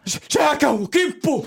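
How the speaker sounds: panicked shout. Acted. Client under raven attack, panicked shout.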